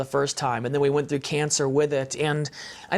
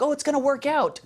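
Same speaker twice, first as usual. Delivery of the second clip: higher, breezy mock-comforting voice; mock cheery reassurance; 8 semitones higher than usual. Husband mocks people reassuring him about cancer, lightly. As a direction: imitate well-meaning friends brushing it off. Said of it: "people are like".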